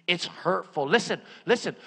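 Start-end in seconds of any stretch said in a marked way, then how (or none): none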